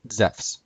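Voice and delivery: Zephs said zefs; modern Greek pronunciation. Shown as a demonstration. Teacher shows Zeus in modern Greek, Zephs said zefs. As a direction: say Zephs as one syllable ending in fs. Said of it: "Zeus would be pronounced".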